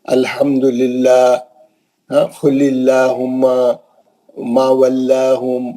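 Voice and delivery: slow recitation with thin normal lam in الحمدللہ; measured recitation of examples; Quranic recitation demo. Shown as a demonstration. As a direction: recite slowly, lam kept light. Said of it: "سب normal پڑ جائیں گے".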